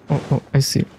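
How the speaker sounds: ordinary talk, nothing marked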